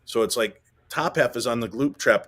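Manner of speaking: ordinary delivery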